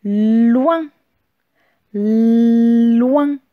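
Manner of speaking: syllabe détachée et appuyée; dictée articulée. Shown as a demonstration. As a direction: dicter bien fort à l'élève. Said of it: "c'est la syllabe". (voix de dictée appuyée)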